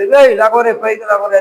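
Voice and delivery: put-on a mother snapping her crying child up; mother scolding child. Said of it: "ఆ తల్లి అంటది". (పిల్లాడిని గద్దించే తల్లిలా గట్టిగా)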